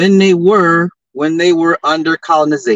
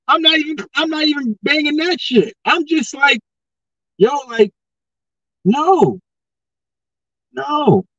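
high, laughing refusal; giggling no; laughing dismissal; 10 semitones higher than usual. Real, not acted. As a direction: laugh it off, pitched up.

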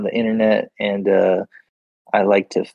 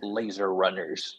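slow, hushed announcer voice; drawn-out game show intro; 2 semitones lower, 10 dB quieter, slower than usual. Pretend. Mock hype announcer intro.

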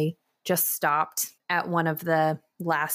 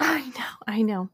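whispering; 4 semitones higher than usual. Real, not acted. Hushed reply to worried friend.

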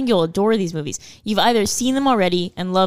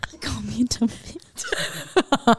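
laughing whisper; 3 semitones higher, 5 dB quieter, slower than usual. Real, not acted.

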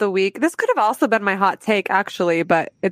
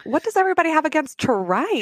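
higher, quick Southern bafflement; put-on Southern indignation; Southern accent; 5 semitones higher, faster than usual. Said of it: "Southern accents".

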